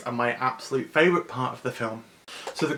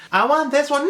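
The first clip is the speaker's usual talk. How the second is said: put-on high, loud child's demand; demanding little girl; 14 semitones higher, 8 dB louder than usual. A girl choosing her dog, high and bossy. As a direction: insist like a stubborn kid. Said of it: "it's just like".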